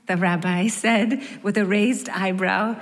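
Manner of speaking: usual speaking voice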